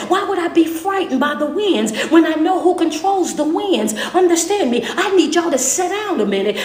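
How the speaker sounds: loud, high, rising sermon cadence; full-force preaching. Acted. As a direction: preach it out to the congregation.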